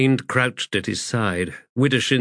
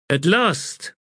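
higher-pitched cutting comeback; sharp retort; 9 semitones higher than usual. A narrator's reading. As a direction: retort with pointed sarcasm. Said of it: "she retorted".

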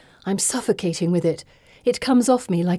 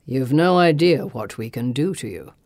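evenly; 5 semitones lower than usual. A narrator's reading. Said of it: "he says evenly".

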